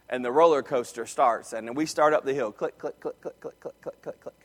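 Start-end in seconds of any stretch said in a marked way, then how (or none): 2.5-4.4 soft clicking imitation. Coaster climbing the hill.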